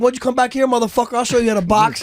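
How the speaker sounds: high-pitched retold challenge; playful comeback. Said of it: "Yeah, that sounds playful".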